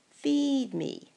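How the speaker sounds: loud, high, insistent "Feed me"; a hungry creature demanding food. Acted. Sign voiced as a demand.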